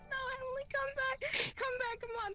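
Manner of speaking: high, imploring call back; urgent pleading. Retold or imitated. Calling drunk friend away from homeless man, pleading.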